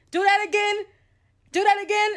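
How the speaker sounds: high, loud eager demand; urging the child to repeat it now; eager mother. Retold or imitated. Mother pointing at her daughter, eagerly high and loud. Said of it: "She's pointing to me".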